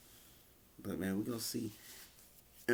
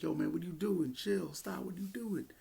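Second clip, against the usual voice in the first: higher pleading voice, imitating Jimmy; mock pleading higher voice, hands-off plea; 10 semitones higher than usual. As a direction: talk him down like a worried brother, pitched up. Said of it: "he's like".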